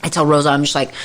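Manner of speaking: normal talking voice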